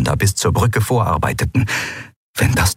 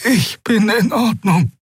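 strained, much higher and slow; husky voice; 14 semitones higher, slower than usual. A narrator's reading. Shaken woman assuring the others she is all right, husky. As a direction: get it out slowly through a thick throat. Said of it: "sagte sie mit belegter Stimme".

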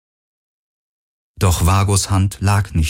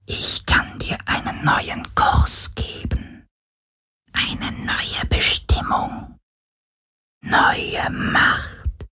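slow, eerie ghostly whisper; plaintive whisper; 5 dB quieter, much slower than usual. A narrator's reading. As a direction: whisper it slowly, luring and mournful. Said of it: "klagende Stimme".

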